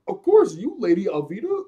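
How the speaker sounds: high, loud, hurried-to-please answer; nervous flattery. Retold or imitated. Crewmate anxiously flattering Lady Alvida, high and loud. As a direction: blurt out eager, high-pitched flattery. Acts Koby flattering his feared captain.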